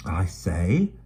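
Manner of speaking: brisk London urchin, chatty; Dido's voice. Acted. Dido suggests instead.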